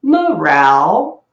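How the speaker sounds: loud, slow meow that dips and rises; imitating the anime cat, drawn out; man-voiced cat meow. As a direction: drag out a human-sounding meow. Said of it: "but in a man's voice".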